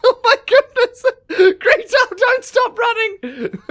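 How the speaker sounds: panicked laughing shriek; breathless fright. Real, not acted. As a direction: scream while laughing in panic. Fleeing bear, laughing panic.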